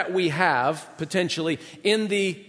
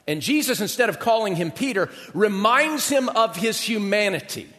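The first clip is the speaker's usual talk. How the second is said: ordinary delivery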